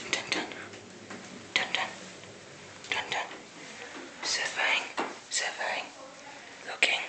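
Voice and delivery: whispered suspense sounds; hushed nature documentary. Pretend. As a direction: hush it, creeping and watchful.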